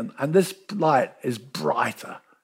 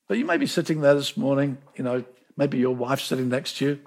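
usual speaking voice

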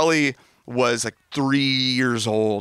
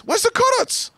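complaining little son. Retold or imitated. Son Zion complains there are no colors, very high child whine. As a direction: squeal the complaint like a small kid. Dad mimics son again.

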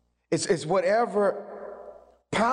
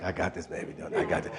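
soft and low, almost a whisper; tender God voice; 13 semitones lower, 7 dB quieter, faster than usual. Acted. God reassuring the believer in a soft whisper. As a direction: hush it like a reassuring father. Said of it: "he's like".

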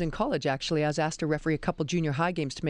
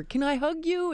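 imitating excited student; 12 semitones higher than usual. Students asking to hug her.

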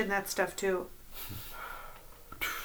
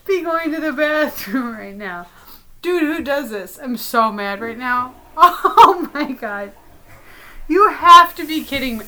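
exasperated laughing; 2 semitones higher, 6 dB louder, slower than usual. Acted. Sammy leaves to use the restroom.

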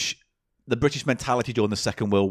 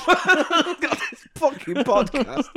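mock exasperation; 13 semitones higher, slower than usual.

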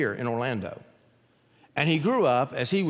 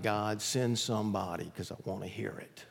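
quiet prayer; 4 semitones lower, 8 dB quieter than usual. Retold or imitated. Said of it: "asking God".

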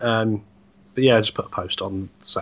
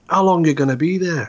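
put-on Scottish accent; 6 semitones higher, 4 dB louder than usual. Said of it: "That was Scottish".

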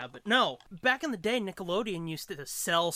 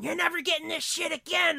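higher mock yell of an angry mom; mom screaming; 10 semitones higher, 3 dB louder than usual. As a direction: scold like a furious mother, half-acted. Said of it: "my mom was screaming at me".

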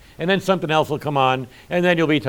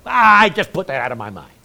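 dismissive daughter-in-law; 6 semitones higher, 6 dB louder than usual. Retold or imitated. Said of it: "her daughter-in-law would say".

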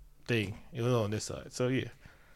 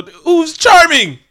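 mock charming; 12 semitones higher, 8 dB louder, much slower than usual. Mocking the charming dealer type.

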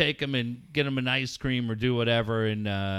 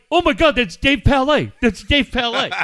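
much higher, much louder gushing; mock starstruck fan; 9 semitones higher, 10 dB louder than usual. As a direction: gush like a fan spotting a celebrity. Imitating starstruck stranger.

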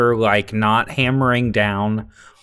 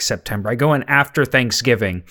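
ordinary talk, nothing marked

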